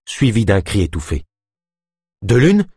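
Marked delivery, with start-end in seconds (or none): 2.2-2.7 calling out. A narrator's reading. Calling into reeds after gunshot.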